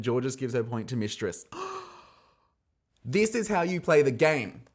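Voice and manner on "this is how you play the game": high, loud, excited declaration; emphatic exclamation. Real, not acted. Host hailing a sly point swap, high loud proclamation. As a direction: proclaim it loudly, pitched up. Host cheers the gameplay, then repeats.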